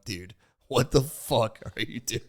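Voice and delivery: imitating a dismayed counselor, slow; slow, drawn-out mock disbelief; exasperated disbelief. Voices exasperated counselor, laughing after.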